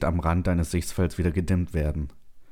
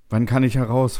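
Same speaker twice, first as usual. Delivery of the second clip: raised, firm demand; firm question; 6 semitones higher, 5 dB louder than usual. A narrator's reading. Settler asks computer about release, surprisingly firm. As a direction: ask it firmly, higher and louder. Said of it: "dass deine Stimme so fest klingt".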